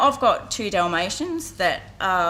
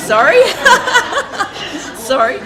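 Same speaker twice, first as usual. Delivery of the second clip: much louder, laughing through an embarrassed apology; real laughter; 10 semitones higher, 14 dB louder than usual. Real, not acted. Speaker catches her own slip before the crowd, laughing.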